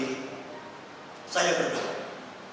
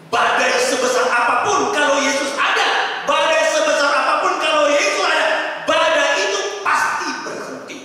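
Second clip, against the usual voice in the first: preaching at full force; 9 semitones higher, 9 dB louder than usual. Acted. Pastor proclaiming to the congregation, loud and rising. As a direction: thunder the promise to the church.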